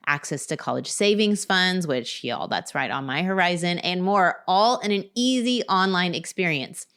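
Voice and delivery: steady, projected sponsor read; podcast ad read. Real, not acted. Host pitching life insurance to parents, polished ad read. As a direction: sell it with evenly emphasized key phrases.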